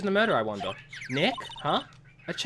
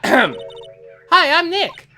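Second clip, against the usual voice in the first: louder call; 8 semitones higher, 8 dB louder, much slower than usual. Acted. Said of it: "Maybe I'm not saying it loud enough".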